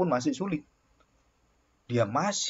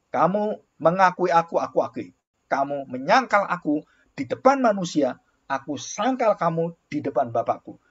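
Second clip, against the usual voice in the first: louder, higher proclaimed verse; preaching scripture; 3 semitones higher, 3 dB louder than usual. Acted. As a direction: declare Jesus's words to the crowd. Quoting scripture with force.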